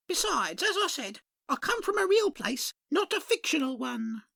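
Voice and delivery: high, sing-song dearie voice; a chirpy shopkeeper greeting at the bell. Acted. Shopkeeper greets a customer, high and sing-song.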